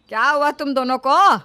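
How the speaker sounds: loud irritated telling-off; mother-in-law scolding loudly; angry scolding. Acted. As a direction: snap at the shouting bahus. Mother-in-law scolds shouting daughters-in-law.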